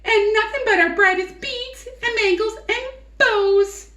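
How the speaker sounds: very high, fast, loud gushing hens; excited giggling hens. Acted. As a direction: squeal with delight like vain hens. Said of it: "the hens began clucking to each other".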